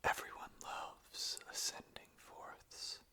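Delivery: slow, hushed lyric reading; slow whispered reading. Acted. Beginning the Ascending Forth lyrics for listeners, slow and whispered.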